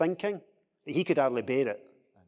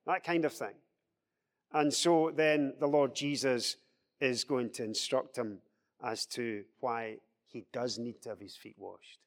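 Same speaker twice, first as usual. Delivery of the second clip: ordinary talk, nothing marked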